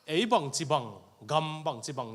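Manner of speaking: ordinary delivery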